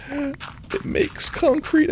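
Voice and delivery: high squeaky echo. Pretend. Playful gravel praise.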